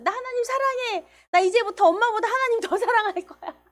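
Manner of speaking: put-on gleeful child's cry, high and loud; excited child. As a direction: shout it out like a thrilled little boy. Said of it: "그 아이가 그다음 너무 신났어요".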